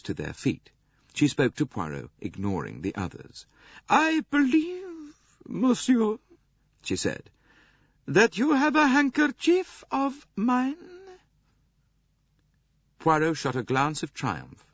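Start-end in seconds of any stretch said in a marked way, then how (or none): 3.9-7.3 very high, slow grande dame voice; imperious old princess. A narrator's reading. 8.1-10.8 very high, slow grande dame voice; imperious old princess. A narrator's reading.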